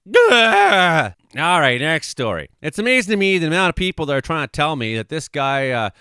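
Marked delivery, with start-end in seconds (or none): 0.1-1.1 loud, high, frustrated blurt; fed-up shout ending the rant; exasperated gah. Real, not acted. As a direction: let out a sudden fed-up yell. Exasperated outburst.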